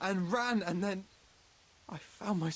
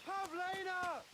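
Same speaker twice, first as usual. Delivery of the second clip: calling out; 9 semitones higher than usual. Acted. Man searching for lost Pavlina, calling out. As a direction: call out far into the woods.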